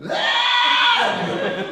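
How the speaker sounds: high, shrill mock shriek of a startled kid; startled kid scream. Kid spots Batman on the roof, high startled shriek. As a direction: shriek like a kid who's just spotted someone on the roof.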